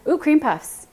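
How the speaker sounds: light up at the idea; sudden happy burst; delighted ooh. Real, not acted. Excited by a chat suggestion.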